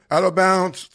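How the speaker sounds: slow, high mock referee call. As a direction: call it out like a ref. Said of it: "I'll be doing cadence".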